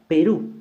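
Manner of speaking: "Perú" with RÚ hit high and loud; final-syllable stress. Shown as a demonstration. Teacher shows a word ending in U, high loud stress on RÚ in "Perú". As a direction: punch the last syllable of "Perú". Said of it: "mayor fuerza de voz en la última sílaba".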